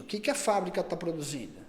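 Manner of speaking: ordinary talk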